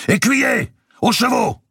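very high, loud call across the yard. Acted. Robert calling his squire to the horses, loud shout. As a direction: bellow for the squire. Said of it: "Puis il appela".

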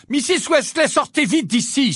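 high, loud urgent shout; urgent cry. A narrator's reading. Harry facing his own corpse, urgent shout. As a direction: cry out to get her away fast. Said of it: "s'écria Harry".

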